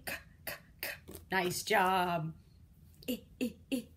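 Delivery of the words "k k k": voiceless "K, k, k" catches; a soft choke in the throat; quiet choking. Shown as a demonstration. Teacher models the quiet k sound for kids. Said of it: "Quiet choking".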